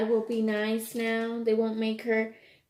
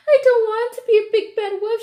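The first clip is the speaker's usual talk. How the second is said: much higher, louder tearful wail; crying wolf cub; 10 semitones higher, 7 dB louder than usual. Acted. Little wolf hiding under the covers and sobbing, tearful wail. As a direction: wail it out through the tears. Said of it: "she cried".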